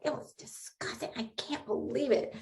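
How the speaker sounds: hushed, horrified whisper; whispered disgust. Retold or imitated. Neighbor still shaken by the armadillo, whispering her disgust. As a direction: whisper it through a shudder. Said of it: "She was like".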